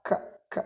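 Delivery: ka with a puff of air out of the mouth; breathy ka. Shown as a demonstration. Teacher shows students the aspirated kaf, airy and soft. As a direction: breathe out hard on ka, almost no voice. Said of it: "Ka yang mengeluarkan udara dari mulut".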